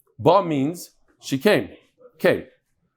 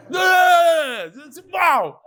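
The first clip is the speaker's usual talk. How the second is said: excited; 11 semitones higher, 3 dB louder, much slower than usual. Real, not acted. Marvels at the biggest pleasure.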